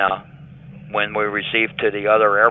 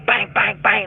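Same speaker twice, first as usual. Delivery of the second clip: punch noises; 12 semitones higher than usual. Retold or imitated. Being punched in the head.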